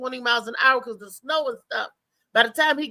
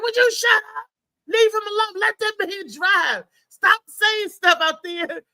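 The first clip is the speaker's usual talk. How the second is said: mock scolding friend; 8 semitones higher than usual. Friend in the back seat telling her to stop, high mock scold. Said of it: "Lee was like".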